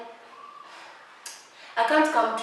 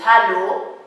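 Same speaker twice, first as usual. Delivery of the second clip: normal talking voice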